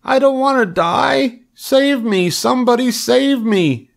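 very high piglet squealing; panicked scream. A narrator's reading. Wilbur begs somebody to save him, panicked scream. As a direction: plead for rescue in a high shriek. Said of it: "screamed Wilbur".

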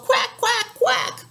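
high quacks, last one breathy; duck call. Acted. Papa Duck calling, quacks trailing off. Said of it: "Papa Duck called".

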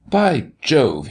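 a restrained narrator's roar; mild roar. A narrator's reading. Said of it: "he roared".

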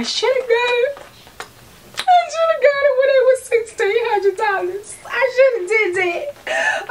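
high wailing regret; mock crying. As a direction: whine it like fake tears.

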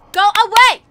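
frightened loud go away; loud shout. Real, not acted. Hiding from monster.

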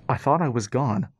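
mildly relieved robot; a touch of relief in a machine voice; relieved mechanical voice. A narrator's reading. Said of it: "mechanical voice said with more relief".

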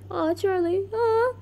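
high-pitched sympathetic coo; cooing aww. Real, not acted. Cooing over a character.

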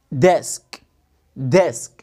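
"Desk" with clear d and short e; sound out desk. Shown as a demonstration. Teacher modelling "Desk" sound by sound. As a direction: say "Desk" crisply, d then short e. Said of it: "الدي مع الإي".

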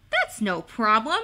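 usual speaking voice

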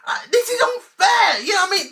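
high hammed-up sob-shout; fake-cry it like a spoilt child; mock crying wail. Fan fake-crying to the camera about the show. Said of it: "I'm annoyed!".

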